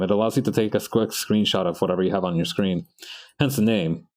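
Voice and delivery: usual speaking voice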